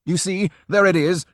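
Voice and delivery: high, loud excited cry; breathless cry. A narrator's reading. Drioli showing the tattoo, breathless and loud. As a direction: show them, out of breath. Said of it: "breathing quickly".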